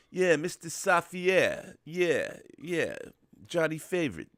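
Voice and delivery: hammed-up, high Brooklyn accent, slow at first; terrible Brooklyn accent. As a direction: do a bad Brooklyn mobster impression. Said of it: "that's a terrible Brooklyn accent".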